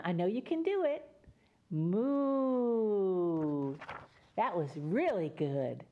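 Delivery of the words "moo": long drawn-out lowing moo; cow moo. Shown as a demonstration. Storyteller leads kids in a mooing chorus, with a long cow moo. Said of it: "Now one more time".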